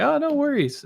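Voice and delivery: light falsetto no worries; chirpy high-pitched mock reassurance; mock high reply. Microsoft replying, mimicked.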